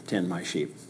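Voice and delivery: very soft, low and gentle; Jesus gently answering Peter; tender reply. Acted. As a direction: answer him tenderly, almost under the breath.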